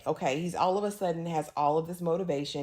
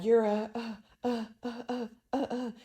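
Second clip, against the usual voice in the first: shocked stammering; 5 semitones higher than usual. Retold or imitated. Madeline seeing bloody-mouthed Claudia, mock shocked stammer. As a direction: act out being too stunned to finish.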